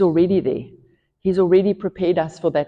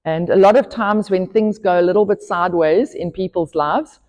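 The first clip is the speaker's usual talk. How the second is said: building preaching; 5 dB louder than usual. Acted. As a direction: hammer home each one who gets blamed.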